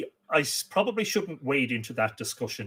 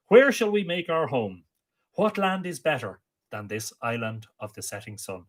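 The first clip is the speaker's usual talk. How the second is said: poem recitation; 8 semitones higher, 7 dB louder than usual. Acted. Amergin landing on Ireland proclaims his song, declaimed. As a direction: proclaim each line like an ancient bard.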